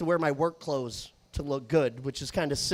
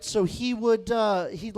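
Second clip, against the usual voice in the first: ordinary delivery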